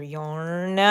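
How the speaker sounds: ordinary delivery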